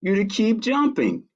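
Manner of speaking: lightly voiced high little girl, insisting; a child who won't let the sheep stop; Ava insisting. Girl refusing to let tired sheep quit, high and insistent. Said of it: "said Ava".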